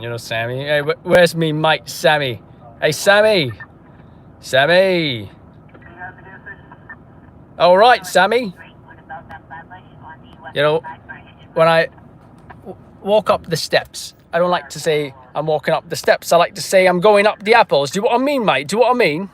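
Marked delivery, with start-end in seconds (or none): 0.7-8.5 loud, hammed-up British mate greeting; British accent. Streamer greeting Sammy whenever Sammy comes on, playful British accent. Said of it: "I do the British accent to Sammy". 10.5-19.3 loud, cheeky mock British patter; British accent. Streamer explaining slang for steps to Sammy, hammed-up British accent. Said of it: "I do the British accent to Sammy".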